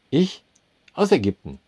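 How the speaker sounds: high, loud startled "Ich?"; startled answer. A narrator's reading. Customer startled by the manager's question, high and loud. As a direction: jump in alarm.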